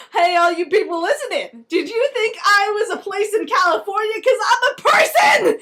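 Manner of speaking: loud, high shouting announcer voice; booming Hollywood. Acted. Hollywood yells to the listeners, high and loud. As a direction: proclaim it to everyone, rising to a shout.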